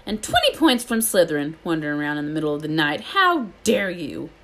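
loud, stern teacher voice; strict professor scolding a wandering pupil. Acted. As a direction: tell him off hard. McGonagall scolding Malfoy.

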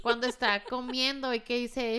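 ordinary delivery

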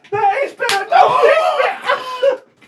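high, loud laughing protest; laughing yells. Real, not acted. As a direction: shriek in panicked laughter. Playfully grabbed, protesting.

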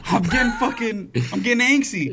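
high, loud laughing protest. Real, not acted.

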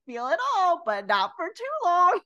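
high, laughing self-parody of her own rule; sing-song the contradiction at yourself; mock inner voice. Her inner voice ordering her feelings, high mock sing-song. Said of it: "I'm like".

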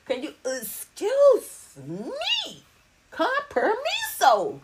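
drawn-out, high-swooping excuse-me; mock-polite demand. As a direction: stretch each word slowly into a pointed request. Acted request to be given space.

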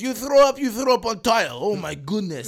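high, shouted Ukrainian scolding; Ukrainian accent. Retold or imitated. Teacher appalled at the mess, loud Ukrainian accent. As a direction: exclaim in outraged Ukrainian accent. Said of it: "Ukrainian teacher".

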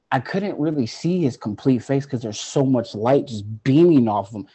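ordinary delivery

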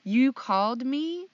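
a light mock of a giggling mom; amused mom. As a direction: play the mom trying not to laugh. Mom laughing on phone.

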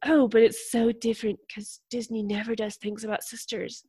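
quietly parroting an annoying opinion; mock quote. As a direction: quote them lightly, in a softened mock voice. Mimicking people who annoyed her.

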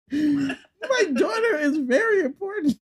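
laughing. Real, not acted. Joking defence of cat daughter.

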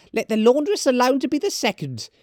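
very high-pitched woman's character voice; high, forward charwoman; bold charwoman. Acted. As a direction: pipe up high, addressing the company.

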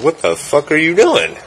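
high-pitched, baffled demand; alarmed disbelief. Retold or imitated. Storyteller to friend who jumped back, high and incredulous. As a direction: imitate a startled, incredulous question. Startled question to jumpy friend.

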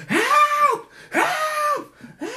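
very high, piercing mock shriek; acted falsetto cries for help. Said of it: "She's shrieking for help".

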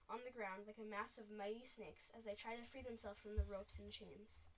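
ordinary delivery, nothing marked

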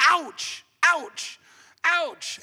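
high mock cries of pain; acted ouch. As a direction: yelp as if bumping a wall. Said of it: "hitting a wall up against and you go".

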